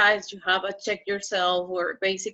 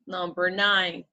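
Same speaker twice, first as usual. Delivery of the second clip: normal talking voice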